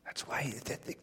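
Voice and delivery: whisper. Real, not acted. Soft aside in sermon.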